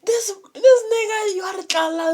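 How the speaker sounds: mimicking a panicked friend, voice jumping high; a high, frantic retelling of the friend's words; high, strained mock quote. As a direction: squeal it out like the upset friend. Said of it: "He's like".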